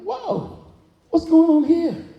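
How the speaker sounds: high startled exclamation. Retold or imitated. Preacher retelling his shock at seeing people crying, high and startled. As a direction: show the shock of walking in. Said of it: "I was like".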